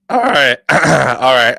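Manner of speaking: losing voice, hoarse and loud. Real, not acted. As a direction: push through a hoarse voice. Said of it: "I'm losing my voice".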